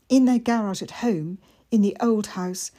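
ordinary delivery

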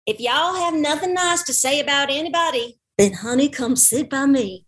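syrupy Southern belle inviting gossip; Southern accent. Shown as a demonstration. Asked to do Southern, sweet Southern accent.

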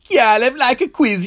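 Jamaican character voice. Acted. Kialef character interjects.

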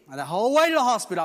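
loud, high, ringing proclamation; preaching at full force. Acted. Preacher to congregation, loud ringing proclamation.

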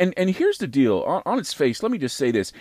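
usual speaking voice

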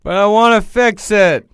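high, loud whiny voice; pleading like a needy child; whiny plea. Pretend. Friend mocking a clingy partner, in a high loud whine.

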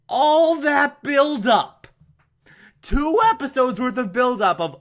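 incredulous complaint; exasperated rant. Real, not acted. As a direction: groan at the letdown, pitch way up. Ranting about wasted build-up.

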